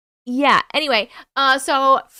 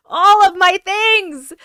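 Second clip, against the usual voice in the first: laughing; 10 semitones higher, 8 dB louder, much slower than usual. Real, not acted. Repeats the phrase, laughing second time.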